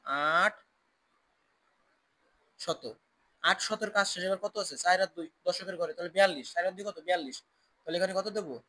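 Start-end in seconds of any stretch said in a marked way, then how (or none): 0.0-2.9 slow demonstration. Shown as a demonstration. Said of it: "এই দেখো".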